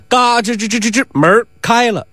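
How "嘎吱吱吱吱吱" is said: high, rattling creak done by mouth; creaking door noise. A narrator's reading. Storyteller opening the gate, imitated creak.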